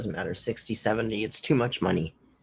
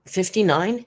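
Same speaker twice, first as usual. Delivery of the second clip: ordinary delivery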